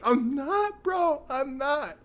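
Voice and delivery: very high, squeaky denial; mock desperate protest. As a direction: whine it high, insisting to your cousin. Retold protest to cousin.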